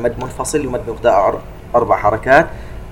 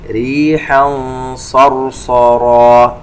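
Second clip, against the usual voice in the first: slow, melodic tajweed chant; measured Quranic recitation; 2 semitones higher, 6 dB louder, much slower than usual. Shown as a demonstration. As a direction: recite slowly with held nasal sounds. Recites verse segment.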